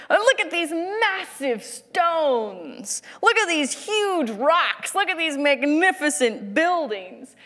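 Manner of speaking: high, drawn-out hammed-up wonder; mood-lifting enthusiasm. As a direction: gush over every word, stretched out slowly. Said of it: "wanting to bring up the mood".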